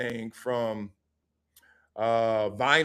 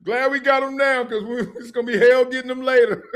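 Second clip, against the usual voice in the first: high, loud laughing quote; laughing hard; 9 semitones higher, 7 dB louder than usual. Retold or imitated. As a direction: repeat the coach's line, cracking up. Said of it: "He was like".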